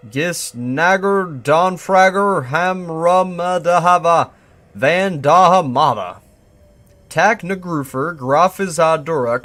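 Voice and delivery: loud, high, slow chant-like reading; gibberish sounded out. Acted. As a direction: belt out each nonsense word syllable by syllable. Reading out garbled whisper transcript.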